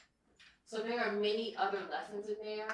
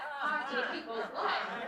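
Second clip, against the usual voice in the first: laughing; 6 semitones higher, 7 dB louder than usual. Real, not acted.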